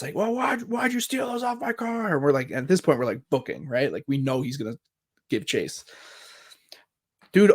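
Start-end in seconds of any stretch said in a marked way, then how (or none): 0.1-2.1 high-pitched mock yell, held back in volume; imitated yelling. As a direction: mimic an outraged car owner without really shouting. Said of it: "like, yelled at us".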